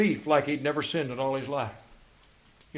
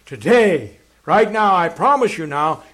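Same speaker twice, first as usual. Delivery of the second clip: crying out; 8 dB louder than usual. Acted. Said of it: "Jesus cries back".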